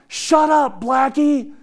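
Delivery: put-on high, loud little-girl yell; child yelling. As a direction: shout it sharp like a six-year-old. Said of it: "she yells out".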